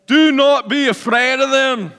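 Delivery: high, loud Scottish battle cry; rally the troops in a put-on Scottish accent; best Scottish accent. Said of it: "my best Scottish accent".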